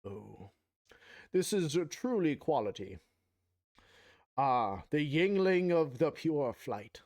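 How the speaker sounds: slow, savoring snooty taster; posh wine connoisseur. Acted. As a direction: savor each word like a sommelier. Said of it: "now I'm starting to sound like an, um, in a nature documentary".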